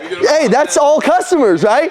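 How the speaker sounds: high, drawn-out cheery sales voice; imitated upbeat kid. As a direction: mimic an irrepressibly happy young salesman. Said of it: "having the best day of his life".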